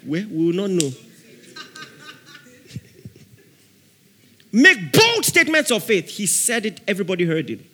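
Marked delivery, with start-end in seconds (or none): 4.5-6.1 declaring. Real, not acted. Preacher's key line.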